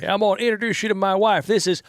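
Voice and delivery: hammed-up Southern man introducing his wife; mock Texan. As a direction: play a loud Texan showing off his wife.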